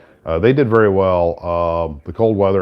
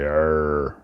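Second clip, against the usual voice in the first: pirate arr; 2 semitones lower than usual. Acted. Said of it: "Talk Like a Pirate Day".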